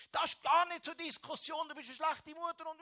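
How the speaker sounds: hammed-up furious yell at the mother; retold angry attack. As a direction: lash out at the mother in a replayed fury. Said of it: "bis ich keine Luft mehr hatte".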